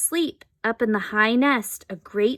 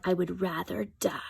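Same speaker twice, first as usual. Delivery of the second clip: hushed, dramatic whisper; 3 semitones lower, 8 dB quieter than usual. A narrator's reading. Boy whispering that he'd rather die. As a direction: breathe it out like a tragic vow. Boy refusing to eat worms.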